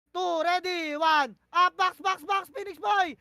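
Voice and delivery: loud countdown, then yelling box, box; shouted callout. Real, not acted. Shotcaller yelling the countdown to his teammates, loud shout. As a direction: bark the count and the push call to the team.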